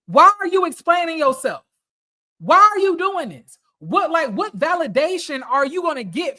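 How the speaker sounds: high, loud, heated questioning; worked-up, pleading frustration; exasperated demand. Real, not acted. Exasperated rant at the man discussed.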